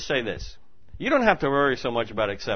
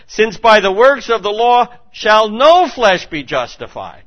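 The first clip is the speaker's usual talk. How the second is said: loud, high scripture proclamation; full-voiced pulpit reading; fervent preaching; 7 semitones higher, 12 dB louder than usual. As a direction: declare it to the crowd.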